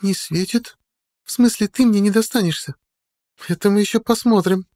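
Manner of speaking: put-on high girlish voice, disbelieving then defiant; девушка Мариона. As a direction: challenge him as a girl, pitched far up. (высокий девичий голос, с вызовом)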